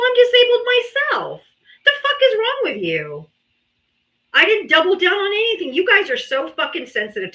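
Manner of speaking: normal talking voice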